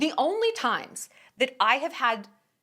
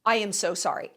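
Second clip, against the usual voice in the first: mock gasp apology.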